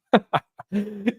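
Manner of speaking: high laugh. Real, not acted. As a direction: crack up mid sentence. Streamer laughing at chat.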